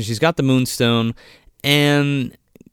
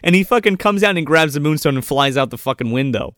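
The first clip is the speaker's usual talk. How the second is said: ordinary talk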